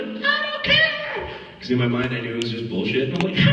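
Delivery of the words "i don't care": very high, drawn-out yell; hammed-up shouted comeback; acted argument. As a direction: scream it back, stretching the words. We really got into an argument.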